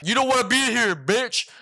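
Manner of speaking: put-on scare the kid like a Scared Straight inmate; yelling inmate. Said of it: "The dudes in the prison are gonna be like".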